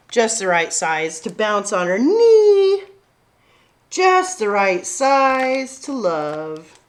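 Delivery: slow, loving read-aloud; gentle bedtime-story pace; tender story reading. A narrator's reading. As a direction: savour each line for the children.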